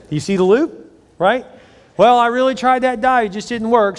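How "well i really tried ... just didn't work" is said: high, loud, put-on justification; mock excuse. Dieter giving up and justifying ice cream, mock excuse. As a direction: plead the failed diet as an excuse.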